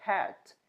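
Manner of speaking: hat com h aspirado no lugar do r; hat, o r virando h; hat errado por rat. Shown as a demonstration. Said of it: "a gente pronuncia como". (hat em vez de rat)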